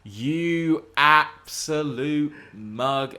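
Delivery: slow, high, loud teasing drawl; mock insult. Real, not acted. Host teases co-host for missing the post-credit scene, slow mock scorn. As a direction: stretch it out to mock your friend.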